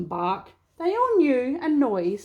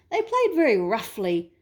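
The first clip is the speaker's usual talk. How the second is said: usual speaking voice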